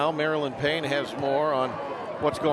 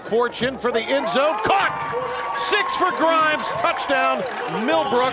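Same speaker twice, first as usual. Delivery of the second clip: high, loud shouted touchdown call; excited touchdown call; 8 semitones higher, 4 dB louder than usual. Real, not acted. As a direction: yell the score as it lands.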